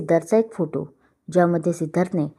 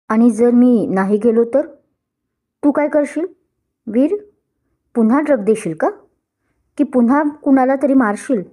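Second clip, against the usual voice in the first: taunting with a laugh; 4 semitones higher than usual. Acted. Rival taunting the groom in front of guests, mocking. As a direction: goad him, sneering. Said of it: "सिद्धार्थने हसत विचारलं".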